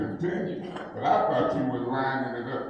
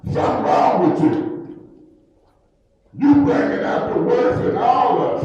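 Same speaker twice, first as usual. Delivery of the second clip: louder and higher, rising in anger; heated rebuke; 7 semitones higher, 4 dB louder than usual. Real, not acted. Councilman berating the chair in open session, heated rebuke.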